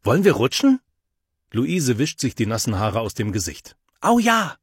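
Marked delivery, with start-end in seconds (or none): 0.0-0.8 high, louder eager call. A narrator's reading. 4.0-4.6 high delighted cheer; excited. A narrator's reading. Girl cheering eagerly.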